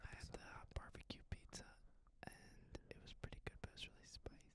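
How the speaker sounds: unvoiced whisper throughout. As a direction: tell it in a breathy hush. Whispered story.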